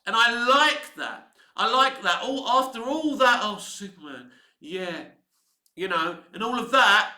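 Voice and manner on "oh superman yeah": softer mock-swooning sarcasm. Lois mocking Superman's naive ideals, softer sarcastic swoon. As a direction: tease him with fake adoration. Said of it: "she's mocking him".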